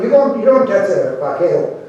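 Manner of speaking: plain reading voice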